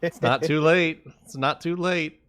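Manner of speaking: eager encouragement. Real, not acted. Urging friend to reroll.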